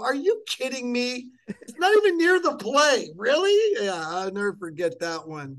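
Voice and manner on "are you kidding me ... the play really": rising, louder disbelieving yell; incredulous outburst. Retold or imitated. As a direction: protest loudly, getting higher each line. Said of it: "I go".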